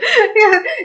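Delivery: very high, loud laugh; real high giggle. Real, not acted. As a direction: burst out laughing. Laughing fit during live chat.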